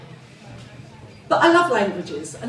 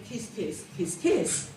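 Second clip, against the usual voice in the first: soft kisses; 5 semitones lower, 8 dB quieter, slower than usual. Retold or imitated. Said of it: "for my friends I thought".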